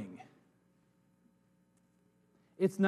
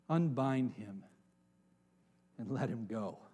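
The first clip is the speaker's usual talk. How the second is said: soft, low, gentle order; quiet command; 7 semitones lower, 8 dB quieter than usual. Acted. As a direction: say it softly, almost tender. Jesus quietly commands release.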